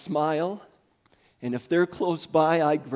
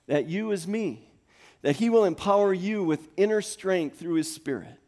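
raised, fervent prayer read to the congregation; preaching prayer; 5 semitones higher, 4 dB louder than usual. Acted. Pastor praying over the congregation, fervent preaching. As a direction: pray it over them with conviction.

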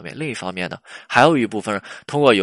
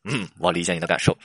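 low, unmoved stock reply; lowered, impassive acknowledgement; canned understanding; 4 semitones lower than usual. Acted. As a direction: play the boyfriend who answers by rote. Boyfriend repeats canned reply, acted.

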